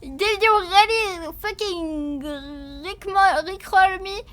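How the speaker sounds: loud, high, slow disbelief; mock outrage. Real, not acted. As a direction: act betrayed and outraged. Outraged at rickroll.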